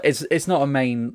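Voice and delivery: usual speaking voice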